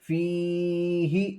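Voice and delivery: फी held long, ही kept short; long-short contrast. Shown as a demonstration. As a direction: stretch only फी, not ही. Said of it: "बिना खींचे इसको पढ़ना है". (फी खींच कर, ही बिना खींचे)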